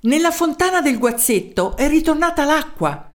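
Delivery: high-pitched report; excited news. A narrator's reading. Townsman telling the mayor about the fountain, excited.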